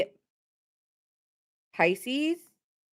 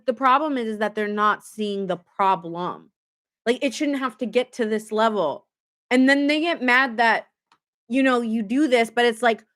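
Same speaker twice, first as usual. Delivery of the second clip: usual speaking voice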